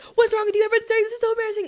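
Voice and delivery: high, loud, berating inner voice; frantic self-criticism. Retold or imitated. Speaker's head scolding them after the choking, frantic. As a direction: shriek it as a panicking mind attacking itself. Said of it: "You'd think they would calm down".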